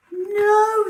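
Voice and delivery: very high loud cry of panic; desperate no. Acted. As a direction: cry out as he sinks. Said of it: "thought Poppy".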